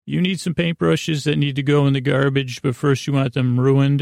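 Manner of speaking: usual speaking voice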